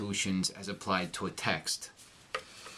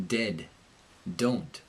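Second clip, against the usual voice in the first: American D in "Dead. Don't", tongue behind the gum ridge; American accent; 3 semitones lower than usual. Shown as a demonstration. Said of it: "in the American accent".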